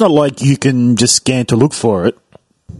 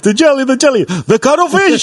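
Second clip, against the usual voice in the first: frantic, much higher squeal; 11 semitones higher than usual. Retold or imitated. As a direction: squeal it out in a panic. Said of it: "And he's like".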